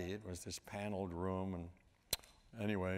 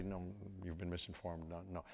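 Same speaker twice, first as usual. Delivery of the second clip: lightly voiced, soft refusal; dismissive lounge managers; 3 semitones lower, 7 dB quieter than usual. Lounge owners to teenage caller, quiet imitated brush-off. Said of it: "Most of them would say".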